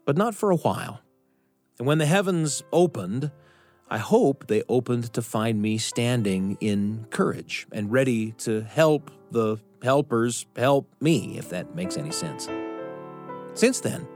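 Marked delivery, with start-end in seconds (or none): none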